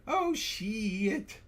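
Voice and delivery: high, drawn-out mock British; British accent. Host retrying the requested phrase, high hammed-up British accent. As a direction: stretch it in a squeaky British accent. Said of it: "in your best British accent".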